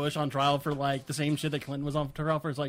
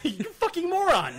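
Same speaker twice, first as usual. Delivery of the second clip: angry rant; 12 semitones higher than usual. Retold or imitated. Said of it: "He freaked the fuck out".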